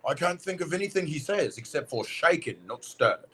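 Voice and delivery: an attempt at the Connery-style Scottish accent; Sean Connery Scottish. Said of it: "Sean Connery Scottish?".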